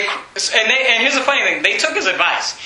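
normal talking voice

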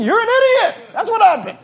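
high-pitched, exasperated comic outburst; mock exasperation. Pastor scolding the clueless church member, mock exasperation. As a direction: let the pitch leap in exasperation at the obvious.

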